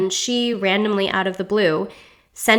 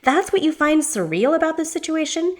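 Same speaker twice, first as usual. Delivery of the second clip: shouting in disbelief; 8 semitones higher than usual. A narrator's reading. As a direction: fire it back, high and incredulous. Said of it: "Diana shouts".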